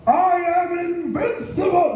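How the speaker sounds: triumphant cry. Retold or imitated. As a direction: crow it after the winning shot. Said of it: "I went".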